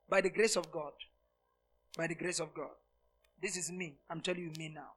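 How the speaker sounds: low and soft, from the heart; hushed confession. Real, not acted. Preacher confiding his heart to the church, hushed. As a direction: drop to an intimate near hush.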